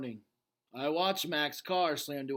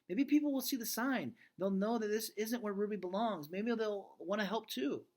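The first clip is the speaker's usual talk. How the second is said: put-on a touch higher, eager young daughter; lightly voiced pleading girl; 8 semitones higher than usual. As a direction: plead like a hopeful kid. Girl explains her hope.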